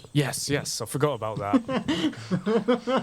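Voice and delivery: sheepish laugh. Acted. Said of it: "he's like".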